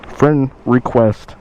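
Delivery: friend request, drawn out slowly; slow repeat. Shown as a demonstration. To viewers about the bike buyer, savoring it slowly. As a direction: let friend request hang, each word slow. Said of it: "I get a friend request".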